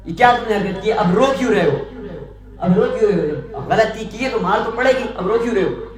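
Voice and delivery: loud, high harsh scolding; harsh scolding king. Acted. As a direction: scold him like a cruel king. Cruel king scolds crying beggar. (ظالم بادشاہ روتے فقیر کو ڈانٹتا ہوا)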